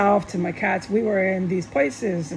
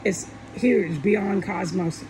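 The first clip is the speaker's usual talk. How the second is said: ordinary talk, nothing marked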